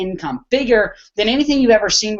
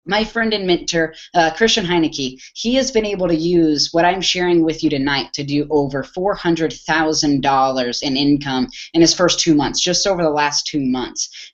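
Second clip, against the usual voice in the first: low, level, matter-of-fact delivery; deliberately unexcited monotone; 3 semitones lower than usual. Shown as a demonstration. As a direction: drop the pitch and keep it level, no hype. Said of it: "the most monotone voice ever".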